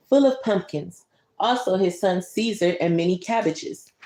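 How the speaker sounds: usual speaking voice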